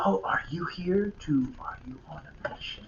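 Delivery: hushed conspiratorial whisper. Acted. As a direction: lean in and whisper the secret question.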